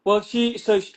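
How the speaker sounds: ordinary talk, nothing marked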